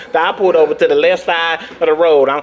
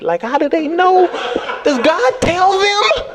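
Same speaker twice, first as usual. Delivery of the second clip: incredulous; 11 semitones higher, slower than usual. Retold or imitated. Acted incredulous reply.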